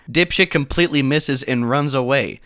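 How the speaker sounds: ordinary talk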